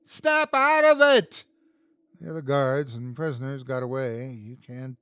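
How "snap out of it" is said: high, loud, slow scream at the guard. A narrator's reading. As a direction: scream to shake him out of it. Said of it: "Will screamed at him".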